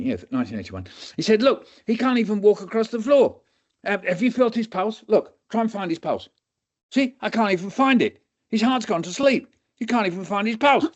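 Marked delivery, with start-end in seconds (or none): 1.4-10.9 much higher, incredulous; astonished doctor. Retold or imitated. Doctor shows students the weak pulse, high. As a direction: marvel at the stopped heart.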